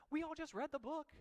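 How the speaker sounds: high, loud whiny protest; whiny student. Retold or imitated. Student protesting to the teacher, whiny.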